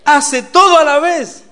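high, very loud, slow punchline; emphatic exclamation. Real, not acted. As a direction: belt it out triumphantly to the audience. Punchline on doing everything.

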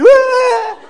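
high, loud comic bawl; mock wail. Preacher teasing women who cry at commercials, mock wail. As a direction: burst into an exaggerated crying wail.

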